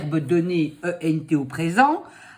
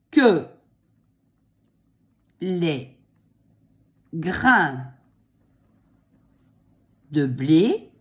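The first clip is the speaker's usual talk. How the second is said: dictating; slower than usual. Shown as a demonstration. Dictating slowly.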